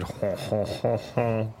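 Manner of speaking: soft, far-off mock ho ho; mock French locals' ho ho, soft; mock locals. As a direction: answer in a silly mock voice. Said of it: "They said".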